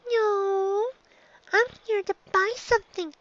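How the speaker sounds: very high little-filly voice; eager young filly. Acted. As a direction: chirp it out, eager again. Filly answers shopkeeper eagerly.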